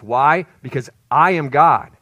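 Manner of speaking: proclaiming loudly. Acted. Preacher proclaims climax loudly.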